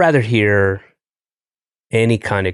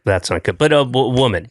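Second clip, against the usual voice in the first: normal talking voice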